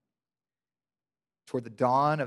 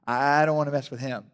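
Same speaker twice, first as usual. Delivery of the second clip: laughing guard quote. Retold or imitated. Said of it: "They're like".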